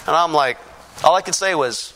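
ordinary talk

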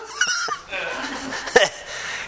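big high laugh. Real, not acted. Preacher laughs at the mom story, big laugh. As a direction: let the laugh burst out.

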